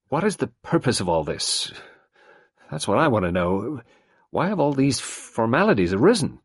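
a touch of irritation, rising toward the end; irritable. A narrator's reading. Lennox pressing Poirot about the formalities, mildly irritated. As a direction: demand an answer, mildly annoyed. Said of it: "Lennox said irritably".

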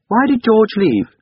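put-on high, loud, slow child's voice; little boy asking. A narrator's reading. Boy asking his mother about George, high childish query. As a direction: pipe up slowly like a small boy.

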